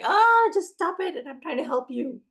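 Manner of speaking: put-on sudden high, loud snap at a screaming child; a frazzled parent losing patience mid-tantrum; exasperated parent. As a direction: blurt it out, fed up and at the end of your rope. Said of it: "you're just like".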